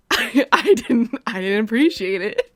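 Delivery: loud, laughing mock-indignant complaint; a hammed-up passive-aggressive jab; Minnesota passive aggressive. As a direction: laugh through it, pointedly put out. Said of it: "Call it Minnesota passive aggressive".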